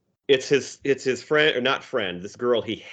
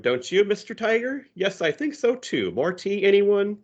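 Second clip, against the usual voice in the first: much higher, prim hostess voice; Susie's voice; 11 semitones higher than usual. Retold or imitated. Susie hosting a tea party with Hobbes, much higher hostess voice. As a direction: play a little girl pouring tea for her toy, pitched well up.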